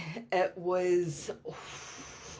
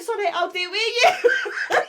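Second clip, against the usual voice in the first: higher and louder, giggling; laughing through quote; 13 semitones higher, 6 dB louder than usual. Retold or imitated. Her son offers to join her, laughing. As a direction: laugh while quoting her boy.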